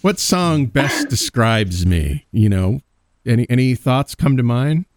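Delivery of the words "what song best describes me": slow, high, musing hum; mock pondering. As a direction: play it thoughtful and confident. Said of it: "You gotta be like".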